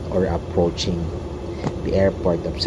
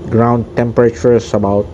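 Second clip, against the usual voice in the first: pilot announcement. Pretend. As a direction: speak like the captain over the intercom. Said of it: "I'll be speaking like a pilot of the plane".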